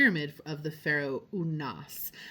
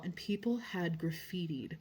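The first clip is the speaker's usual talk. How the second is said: whispered, as if shocked at what she found; hushed whisper; 4 dB quieter than usual. Real, not acted. Vlogger revealing graffiti on the tomb wall, whispered. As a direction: drop to a stunned whisper.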